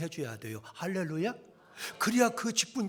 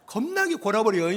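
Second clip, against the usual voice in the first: loud, folksy Jeolla dialect; 5 semitones higher, 10 dB louder than usual. Shown as a demonstration. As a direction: play up the Jeolla dialect for laughs. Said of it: "그 전라도 말 쓰니까". (큰 소리로 구수한 전라도 사투리)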